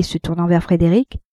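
ordinary delivery

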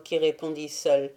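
ordinary delivery

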